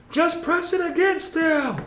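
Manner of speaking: piercing falsetto cry; sustained high-pitched screech; high-pitched priest. Acted. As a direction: call it out very high and urgent. Game master voices the shrieking priest.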